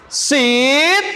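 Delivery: high, drawn-out call; seruan bernada. Shown as a demonstration. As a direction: stretch it out high for the class to echo. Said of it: "ada nadanya, ada intonasinya". (seruan tinggi dan panjang)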